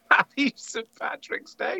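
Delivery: high, sing-song hammed-up holiday cheer; lightly mocking festive well-wisher; mock cheery greeting. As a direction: chirp it like a forced party greeting.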